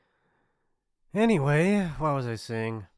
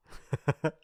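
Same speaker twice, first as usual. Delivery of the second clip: short scoffing chuckle; mocking laugh; 4 semitones higher than usual. Bully amused at an unwelcoming victim, mocking chuckle. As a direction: laugh at their discomfort. Bully mocks visitor's discomfort.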